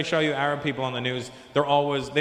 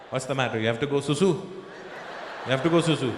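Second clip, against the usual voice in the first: lightly voiced Indian parent. Parent sending a little kid to the bathroom, lightly voiced coaxing. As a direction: gently urge a child along.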